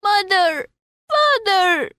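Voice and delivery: high, tearful calls; crying out. Acted. Lost child crying for his parents in the crowd. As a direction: sob out each name.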